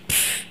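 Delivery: soft puff of air; imitated poof. Speaker showing commented code slipping into production, soft puffed poof. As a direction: blow out a quick, quiet "puf".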